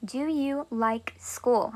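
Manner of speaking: repeating the question softly; slow and soft. Shown as a demonstration. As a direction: ask it gently and slowly. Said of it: "Let's say I wanna ask you a question".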